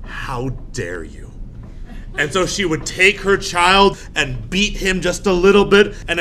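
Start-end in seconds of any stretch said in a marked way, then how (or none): 0.1-1.3 cold scolding. Retold or imitated. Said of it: "And she says".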